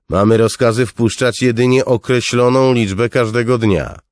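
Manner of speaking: usual speaking voice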